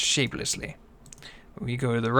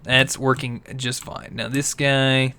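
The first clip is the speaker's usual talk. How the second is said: ordinary talk, nothing marked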